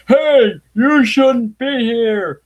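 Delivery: high, slow sneering mock voice. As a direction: sneer it out as a cartoon bigot.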